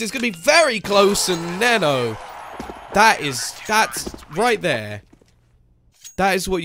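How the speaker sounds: high, loud esports shoutcast; excited play-by-play. Real, not acted. Caster calling a very close defuse, highly excited. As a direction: build to a peak on the clutch moment.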